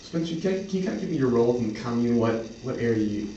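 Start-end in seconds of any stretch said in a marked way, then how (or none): none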